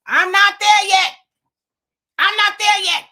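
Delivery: imitating dad, high and loud, digging in; stubborn dad. Son imitates dad turning down his offer, insistent and loud. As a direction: play the stubborn old father refusing help, loud and high.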